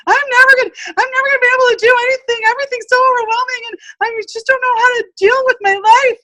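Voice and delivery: high, fast, frantic rush; overwhelmed panic. Retold or imitated. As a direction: spill it out in a panic. Retelling her overwhelmed panic.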